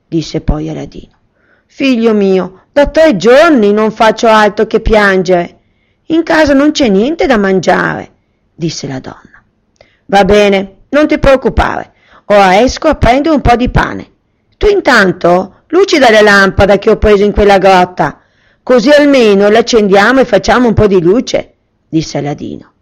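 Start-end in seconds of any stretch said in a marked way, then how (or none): 1.6-8.1 a mother who has cried for days; mother telling her son there is no food, sorrowful; weary mother. Acted. Mother voiced in story. 10.1-21.5 put-on higher and louder, a boy comforting his mother; reassuring young Aladdin. Aladdin voiced in story.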